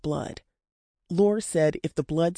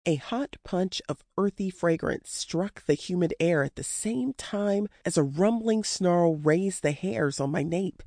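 usual speaking voice

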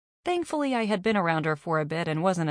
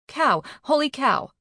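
raised, loud repeat; corrected exclamation; 8 semitones higher, 5 dB louder than usual. A narrator's reading. Carney covers her near swear, loudly correcting herself. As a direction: insist on the cleaned-up word. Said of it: "Carney quickly corrected herself".

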